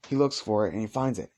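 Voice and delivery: ordinary delivery, nothing marked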